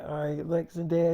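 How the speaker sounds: a tired sigh of giving in; weary and soft. Retold or imitated.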